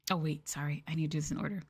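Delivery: ordinary talk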